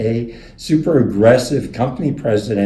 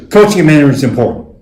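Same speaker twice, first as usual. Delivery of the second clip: loud defensive outburst; 6 semitones higher, 10 dB louder, much faster than usual. Retold or imitated. John blurts out defensively.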